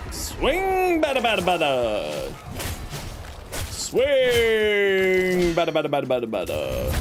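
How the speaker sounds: high swing call then rapid bada; playful battle noises. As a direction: hype up each swing, playful. Sound effects during attack.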